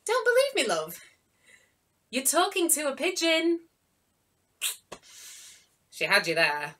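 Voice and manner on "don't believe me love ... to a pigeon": cheeky pigeon. Acted. Pigeon teases.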